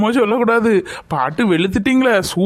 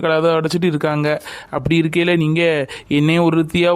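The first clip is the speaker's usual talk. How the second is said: ordinary talk, nothing marked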